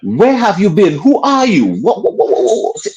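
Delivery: stammering, high-pitched amazement; excited disbelief. Retold or imitated. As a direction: gush like a starstruck fan. Retelling shock at legend's call.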